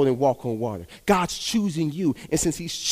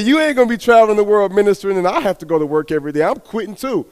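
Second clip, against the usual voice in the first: loud, fast and higher, all fired up; wife announcing she quits too, loud and eager; excited wife; 6 semitones higher, 6 dB louder than usual. Retold or imitated. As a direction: burst out with her big decision. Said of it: "She said".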